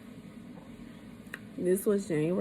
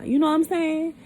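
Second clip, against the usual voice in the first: usual speaking voice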